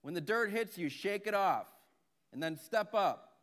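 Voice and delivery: loud, high shouted instructions; yelling down. Acted. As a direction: holler instructions down into a well. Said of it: "So the farmer yells down to him".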